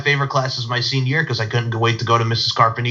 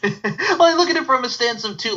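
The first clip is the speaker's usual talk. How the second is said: much higher pitch, breaking up with laughter; laughing; 13 semitones higher than usual. Real, not acted. Host cracking up at guest while restarting his question, laughing. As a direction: try to get the question out through real laughter.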